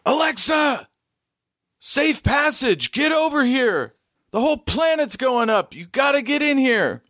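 high, urgent shouted pleas; a high frantic woman begging you aboard; frantic stranger. Acted. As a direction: call out desperately to Alexa. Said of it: "she goes".

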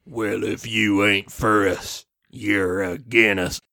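deep hillbilly threat. Acted. Big Daddy Macolt threatening.

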